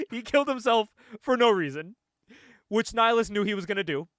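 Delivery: can barely keep a straight face; laughing. Real, not acted. Recounting the hero's pointless death, laughing through it. Recapping the twist while laughing.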